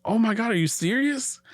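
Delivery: high-pitched retold astonishment; incredulous quoted outburst; acted disbelief. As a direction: replay your stunned reaction, pitch jumping up. Acts out stunned reply to screaming.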